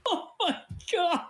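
high shrieking laugh; shrieking laughter. Real, not acted. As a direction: lose it completely. Sound replayed, host cracks up.

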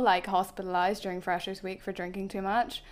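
usual speaking voice

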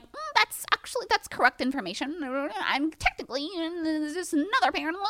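high, fast, nasal-free snooty correcting voice; mock know-it-all. Mimicking strangers correcting her in DMs, high fast snooty voice. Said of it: "people I don't know being like".

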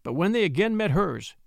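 usual speaking voice

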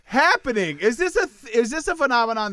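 high, loud incredulous question; mock outrage. Real, not acted. Host asking co-hosts about the nosy friends, high and incredulous. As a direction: demand an answer in disbelief.

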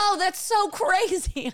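high, slow, drawn-out whoa; amazed stranger. Retold or imitated. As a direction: play up a stranger's gushing wonder. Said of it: "people are like".

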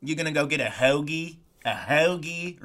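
a light attempt at a Philadelphia accent on "hoagie"; half-hearted Philadelphia accent demo. Shown as a demonstration. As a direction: try a Philly accent, stretching out "hoagie". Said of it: "the Philadelphia accent's way too hard 'cause it's like".

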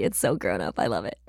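low mock-grownup. Real, not acted.

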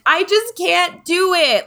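put-on high, slow childlike whine; whiny giving up. Adult voicing the inner child who wants rescue, whiny. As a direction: whimper it like a kid at the end of her rope. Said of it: "what we're doing when we're just like".

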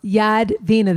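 "Yadvina" with an open ah and no R; name without R. Shown as a demonstration. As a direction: correct it to "Yadvina", first syllable Yahd without R. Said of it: "There's no ah".

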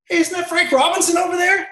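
high, loud imitation of an eager cousin; excited cousin. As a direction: blurt it out, mock starstruck. Cousin excitedly spots Frank Robinson.

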